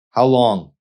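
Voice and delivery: loud sharp angry question. Acted. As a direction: bark the demand. Angry demand in quarrel.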